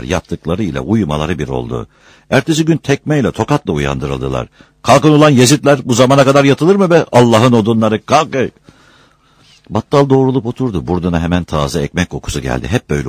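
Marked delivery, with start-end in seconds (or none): 4.8-8.4 high, loud scolding wake-up; angry shouting. Acted. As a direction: berate the sleepers out of bed. Sleepers woken with kicks and slaps. (öfkeyle bağırarak azarlama)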